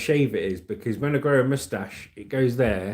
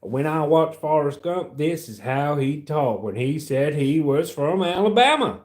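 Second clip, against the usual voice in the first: Alabama accent; 3 semitones higher than usual. Shown as a demonstration. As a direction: do a Forrest Gump-style Alabama accent. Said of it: "This is my Alabama accent".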